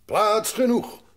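put-on loud, high old man voice; gruff old grandpa. Grandpa answering Heidi from below, loud and high-pitched. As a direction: boom it out like a cheerful grandpa.

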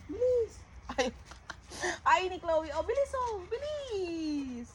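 high, soft coaxing of dogs; coaxing pet talk. Real, not acted. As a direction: hurry the dogs along sweetly. Urging dogs to eat. (malambing na pagmamadali sa aso)

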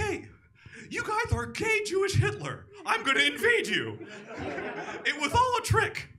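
ranting villain in a squeaky voice; Putin. Pretend.

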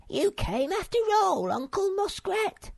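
very high, loud little creature voice; exclaimed. A narrator's reading. Sniff greets Uncle Muskrat, high surprised exclamation. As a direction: squeal it in surprise, very high-pitched. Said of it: "exclaimed Sniff".

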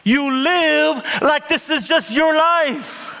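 high, loud impassioned preaching; soaring sermon shout over music; preaching at full force. Acted. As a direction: lift the room like a revival preacher.

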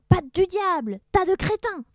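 high, loud pirate cursing; furious yell. Acted. Blackbeard yells at crew in the sea. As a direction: curse them at full voice.

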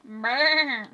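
badly faked sheep bleat; one drawn-out, amateurish baa; bad sheep impression. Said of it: "really bad sheep impression".

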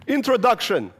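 put-on high, loud, proud Russian-accented kid; high proud Russian child voice; solemn title reading. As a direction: announce it like a grand opening. Child reading his title aloud.

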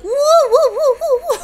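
high wavering "woo" noises; silly high undulating woos; wave imitation. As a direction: imitate rolling waves with high whooping. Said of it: "They're like waves, like".

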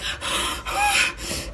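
long breathy sigh of panic; a big exasperated exhale. Retold or imitated. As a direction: let out an overwhelmed sigh. Overwhelmed while sweating and panicking.

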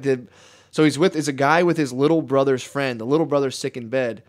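ordinary delivery

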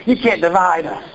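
ordinary talk, nothing marked

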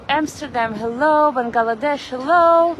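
normal talking voice

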